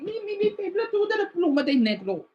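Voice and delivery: high-pitched Mexican Spanish fretting, stammered start; Mexican Spanish dialect. Acted. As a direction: act out a frantic search in Mexican Spanish. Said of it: "grew up with the Mexican".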